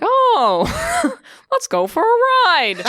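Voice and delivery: high giddy squeal breaking into laughs; excited laughing. Retold or imitated. Drunk friends spotting the chariot, laughing excitedly. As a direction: gleefully giggle the suggestion.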